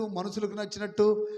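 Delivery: usual speaking voice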